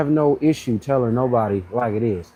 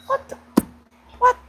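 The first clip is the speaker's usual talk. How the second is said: incredulous disbelief. Retold or imitated. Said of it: "I'm like".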